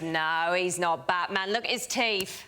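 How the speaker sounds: imitated Cockney lad; Cockney accent. Other boy correcting his friend about Batman, Cockney accent. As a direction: dismiss it in a put-on Cockney accent. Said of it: "I think it's a Cockney accent".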